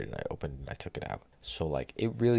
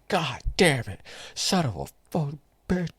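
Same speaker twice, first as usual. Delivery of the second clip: loud, high furious swearing at the counter; angry cursing; 11 semitones higher, 6 dB louder than usual. Retold or imitated. Thwacking the air fryer basket, yelling angry curses. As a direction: curse it out while whacking towels. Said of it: "I'm so angry at myself".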